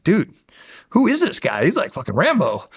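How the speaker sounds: high-pitched, animated amazement; amazed disbelief. Retold or imitated. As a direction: retell it as an amused, incredulous question. Amazed retold question to teammate.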